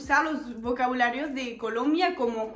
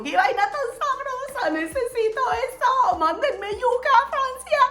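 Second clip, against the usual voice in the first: voz mucho más aguda, exclamando con risa; antojo emocionado; 11 semitones higher, 3 dB louder than usual. Real, not acted. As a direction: como si se muriera por esa yuca. Antojo de yuca colombiana. (exclamación antojada y aguda, entre risas)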